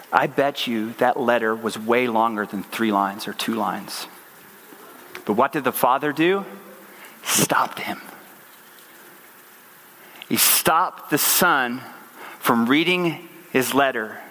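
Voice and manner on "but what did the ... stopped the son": normal talking voice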